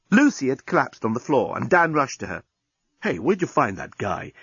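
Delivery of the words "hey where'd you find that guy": ordinary delivery, nothing marked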